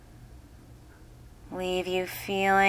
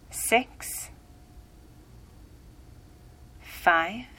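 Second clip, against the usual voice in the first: rousing countdown; 4 dB quieter than usual. Acted. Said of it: "I'll soon count backward from ten".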